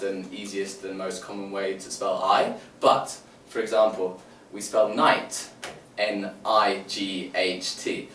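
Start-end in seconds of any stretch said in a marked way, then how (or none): 6.0-8.1 "N I G H T" spelled slowly as letter names. Shown as a demonstration. Teacher spelling night for the kids. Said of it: "I'm saying it with the letter names".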